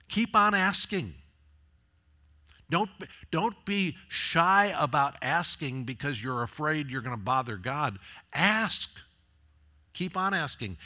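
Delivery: the preacher voicing Jesus calls out to keep asking, in full preaching cry; preaching at full force. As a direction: urge the congregation on, each command ringing out. Preaching Jesus' ask-seek-knock words.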